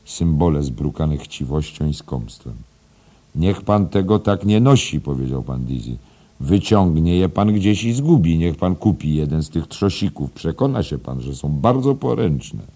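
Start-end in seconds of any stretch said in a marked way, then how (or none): 3.4-5.0 higher, louder advice; schoolmaster admonishing. Acted. Headmaster advising his young teacher about money, lightly acted admonishing. 6.4-12.6 lightly voiced, insistent older man; schoolmaster giving advice. Headmaster urging teacher to buy a coin box, lightly insistent.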